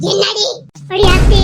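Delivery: loud, high yell from the other room; yelling back. Acted. Elder sister yelling back at her little sister, loud. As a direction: shout it across the house.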